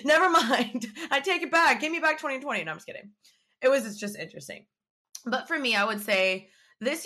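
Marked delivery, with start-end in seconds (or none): 0.1-2.6 high, louder comic backpedal; laugh, then plead in mock panic; joking high-pitched plea; mock retraction. Jokingly begs for last year back.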